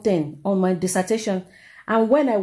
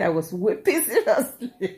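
laughing. Real, not acted. Student telling viewers she was weeping, laughing as she speaks. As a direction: laugh breaking into the words.

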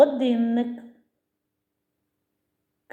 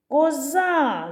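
«Коза» called higher, final а held long; calling out; 5 semitones higher than usual. Shown as a demonstration. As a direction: call ko-ZAAA, stretching the stressed а in «Коза». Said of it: "«а» наголошений". (кличе протяжно, наголос на «а» в слові «Коза»)

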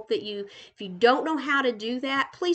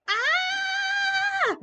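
very high squeal of delight. Real, not acted. Touring a friend's island, a high delighted squeal. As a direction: squeal like a thrilled fan.

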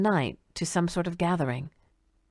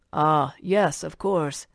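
a lightly voiced old man musing; much slower than usual. A narrator's reading. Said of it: "the old man said".

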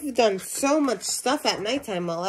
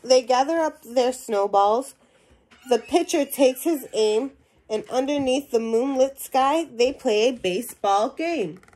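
lively storybook reading to a class; animated read-aloud voice for young listeners; reading to children; 6 dB louder than usual. Acted. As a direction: read it out like a picture book for kids. Teacher reads picture book aloud.

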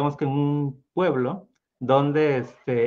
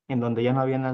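ordinary delivery, nothing marked

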